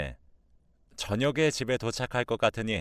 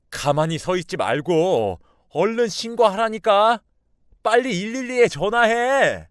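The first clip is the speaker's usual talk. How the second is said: loud, high, exasperated yelling; raised angry voice; 10 semitones higher, 8 dB louder than usual. A narrator's reading. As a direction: yell at someone frozen to act now, dragging the last words. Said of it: "제가 언성이 높아지고 화를 내니까". (언성을 높여 화내며 재촉)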